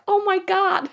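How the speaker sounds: high, put-on American accent. S1 mimics a guy by the pool, American accent. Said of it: "this is my American accent".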